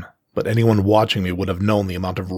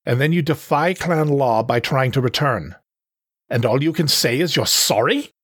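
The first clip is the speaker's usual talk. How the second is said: higher, scolding voice; indignant panther leader; 5 semitones higher than usual. Acted. Panther berates the cub before the crowd, indignant. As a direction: berate them with rising disbelief.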